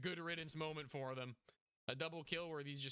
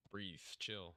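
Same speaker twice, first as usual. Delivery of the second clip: calming self; 7 semitones lower, slower than usual. Real, not acted. After the tense round, low calming words. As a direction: talk yourself down slowly.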